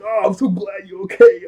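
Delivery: high, loud gushing reunion voice; mock gushing relief. Friends hug after the blast, mock gushing relief. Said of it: "they start to hug".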